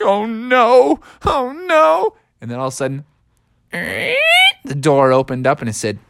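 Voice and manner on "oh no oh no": high, acted fearful moan; frightened dread. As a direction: moan as danger approaches. Froggy frightened by footsteps.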